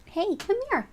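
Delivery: high, soft coaxing voice for a cat; pet talk. Real, not acted. Calling the cat over to say hi, high soft coo.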